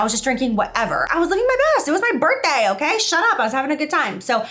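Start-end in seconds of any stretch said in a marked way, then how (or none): 1.1-3.0 excited. Real, not acted. Excited birthday brag. 3.0-3.4 high teasing snap; mock-defensive, jokey; playfully telling viewers to hush; playful shut up. Real, not acted.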